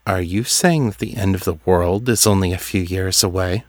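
ordinary delivery, nothing marked

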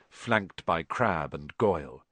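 plain reading voice

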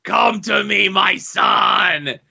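put-on booming villain voice; the Master. Shown as a demonstration. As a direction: stretch the name and call out like a hammed-up cult master. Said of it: "I'm gonna do the Master's accent".

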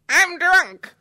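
high, loud tipsy shout; hammed-up drunken whoop; drunk celebrity. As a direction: blurt it out like a giddy drunk. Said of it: "Speaking of drunk, though".

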